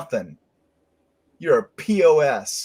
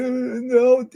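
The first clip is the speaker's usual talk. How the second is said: imitated whining baby; 11 semitones higher than usual. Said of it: "She's like".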